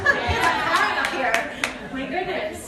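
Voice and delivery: slow, winded and breathless; out of breath. Real, not acted. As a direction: catch your breath between words. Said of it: "So I'm a little winded".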